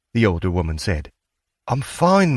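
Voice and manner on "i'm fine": put-on high, brushing-off young voice; teenage girl. A narrator's reading. Daughter brushing off her mother, high dismissive voice. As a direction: wave off the fuss.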